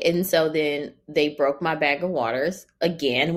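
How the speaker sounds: ordinary talk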